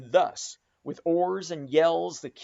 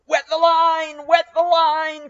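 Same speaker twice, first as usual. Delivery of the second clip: high, loud slow shouted order; cry out; 12 semitones higher, 12 dB louder, slower than usual. A narrator's reading. Stubb crying to the tub oarsman, loud slow shout. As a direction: bellow the order down the boat. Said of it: "cried Stubb to the tub of oarsmen".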